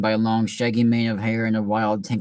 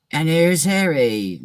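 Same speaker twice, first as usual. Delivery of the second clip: slow, higher, drawn-out greeting from a giant; slow Hagrid voice; 6 semitones higher, much slower than usual. Acted. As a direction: savour each word like a gentle giant. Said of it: "Should I give Hagrid a voice?".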